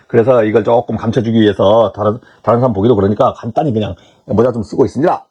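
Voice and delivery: low, hesitant polite explanation; apologetic candidate. Acted. Candidate explaining to an interviewer why he wears a hat, apologetic.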